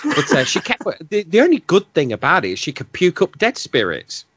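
usual speaking voice

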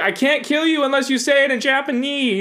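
high giggly spooky ghost; laughing ghost voice. Pretend. Ghost telling you to speak Japanese, high and laughing.

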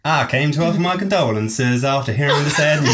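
badly faked American southern accent; shaky southern accent. Alicia offers condolences in a wobbly southern accent. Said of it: "I can't do the accent".

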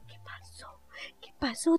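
whisper. Retold or imitated. Mother voiced waking up.

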